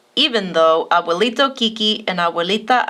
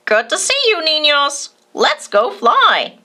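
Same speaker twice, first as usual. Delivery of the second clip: parrot voice; 6 semitones higher than usual. A narrator's reading. Parrot kite speaks to boys.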